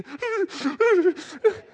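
put-on high, loud shuddering child sobs; hammed-up whimper; imitated whimpering. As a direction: mimic a little boy crying. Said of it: "he's still whimpering".

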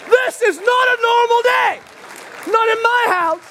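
high, loud incredulous protest; disbelief. Retold or imitated. Husband to calm wife: incredulous. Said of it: "looking at my house like".

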